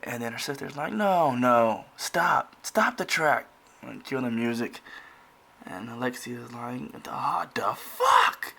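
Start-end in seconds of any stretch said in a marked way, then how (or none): 0.9-3.5 loud, high-pitched exasperated halt; acted sister's protest. Acting out angry sister's interruption. 7.0-8.5 high, drawn-out incredulous cry; slow build to an indignant outburst; acted outraged disbelief. Acting out Alexia's outraged disbelief.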